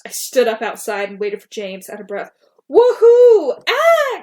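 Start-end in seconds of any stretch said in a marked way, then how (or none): 2.7-4.2 high, loud joyful whoop; excited scream. Retold or imitated. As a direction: whoop it out at full volume. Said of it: "I screamed".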